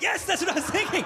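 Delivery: high-pitched, elated yes; excited agreement. Acted. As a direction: act out delighted agreement, voice shooting up. Husband thrilled his plan is guessed.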